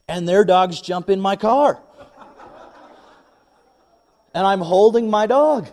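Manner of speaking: loud, rising indignant storytelling; worked-up, incredulous retelling; exasperated outrage. Retold or imitated. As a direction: let outrage climb high and loud. Retelling his mounting outrage at the dogs.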